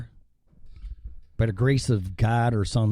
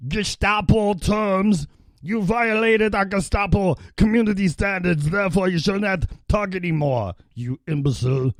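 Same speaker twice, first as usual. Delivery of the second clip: higher-pitched, hammed-up Gestapo censor; mock Gestapo officer; 7 semitones higher than usual. Gestapo censor rebukes a violator of community standards, hammed-up officer voice. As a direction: act out an officious censor handing down a ruling.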